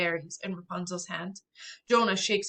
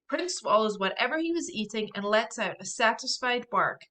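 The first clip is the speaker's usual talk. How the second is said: ordinary delivery, nothing marked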